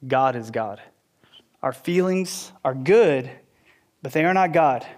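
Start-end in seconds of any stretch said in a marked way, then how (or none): none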